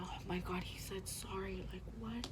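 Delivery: high, whispered mock gushing; mock giddy disbelief. Mocking own stupid reaction to his apology, giddy whisper. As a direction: squeal it under your breath, hammed-up. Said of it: "my stupid ass".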